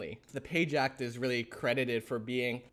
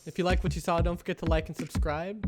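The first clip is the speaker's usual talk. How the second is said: upbeat, louder sign-off pitch; outro announcer read; 3 semitones higher, 5 dB louder than usual. Acted. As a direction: pump up the outro promo.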